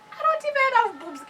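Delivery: high, loud mock whine; indignant protest. Daughter defending herself to her mother, high indignant whine. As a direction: protest in a high-pitched child's complaint. Acting out child's protest to accusation.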